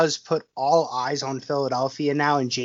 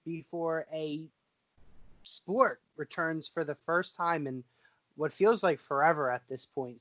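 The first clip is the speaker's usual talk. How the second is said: much softer, as if the mic cut out; faint signal after a scream overloads the mic; mic volume dropped; 3 semitones higher, 10 dB quieter than usual. Real, not acted. Biscuit hypes baseball's return, voice dropped very low on the mic. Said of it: "it got really low".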